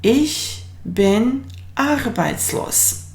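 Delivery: slow clear German sentence; slow model sentence. Shown as a demonstration. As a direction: model it slowly for the class. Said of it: "So the sentence will be".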